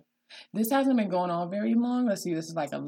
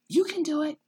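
high, gentle coaxing; encouraging parent; 13 semitones higher than usual. Retold or imitated. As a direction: coax a tearful child onward. Parent coaxing crying child to read.

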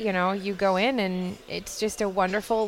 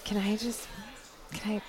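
soft breathy imitation of a friend; quiet, airy, hesitant ask; 10 dB quieter than usual. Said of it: "I didn't say it with a breathy voice like that".

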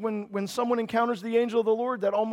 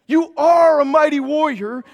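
a soaring shout of the line; calling the congregation mighty warriors; loud proclamation; 5 semitones higher, 10 dB louder than usual. Shown as a demonstration. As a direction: proclaim You are a mighty warrior with full force. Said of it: "if he says, "You are a mighty warrior".

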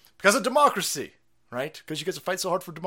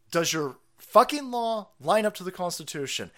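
normal talking voice

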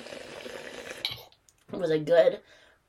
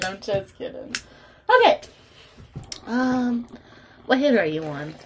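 ordinary talk

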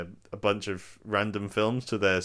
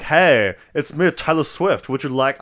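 bright, loud and high, slow celebrity greeting; 6 semitones higher, 10 dB louder, slower than usual. Retold or imitated. Pop star greeting fans in a Coke ad, loud, high and bubbly. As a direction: put on a bubbly pop-star voice, louder and higher. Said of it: "That's not how [name] talks".

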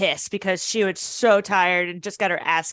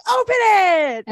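excited command; 12 semitones higher, 7 dB louder, slower than usual. Pretend. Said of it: "and I'm like".

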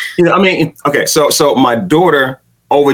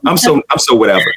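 ordinary delivery

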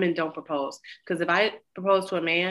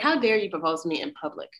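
normal talking voice

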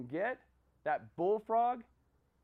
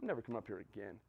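dismissive grumble; 9 semitones lower, 10 dB quieter, faster than usual. Retold or imitated. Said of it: "he's like".

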